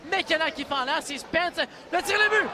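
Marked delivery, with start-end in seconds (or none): 1.9-2.5 sudden high goal shout; excited sports commentary. Real, not acted. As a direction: snap up into the goal call. Quick goal called. (cri du but soudain)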